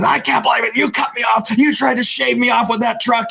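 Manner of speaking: mock angry cop. Trooper scolding the speeding driver, mock yelling high. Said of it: "He goes".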